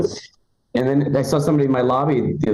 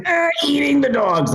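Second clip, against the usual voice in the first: very high, strained grunting impression; grunted Donald Trump. Comic shows the effortless impression, grunted Trump. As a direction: push it out like a grunt on the toilet. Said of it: "if you're grunting, just, it comes out".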